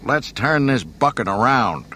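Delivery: rally the copilot with an order. A narrator's reading. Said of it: "he ordered".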